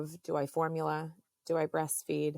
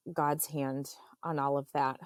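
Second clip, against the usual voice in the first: ordinary delivery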